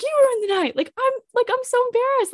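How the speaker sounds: high, mortified complaint; embarrassed outburst. Retold or imitated. To the date who ruined the night, high mortified cry. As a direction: pitch it up in hurt disbelief.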